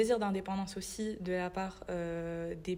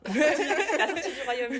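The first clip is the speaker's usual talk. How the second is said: giggling through the words; high, fast laughing correction; 7 semitones higher, 8 dB louder, faster than usual. Real, not acted. Giggling over a slip. (en riant)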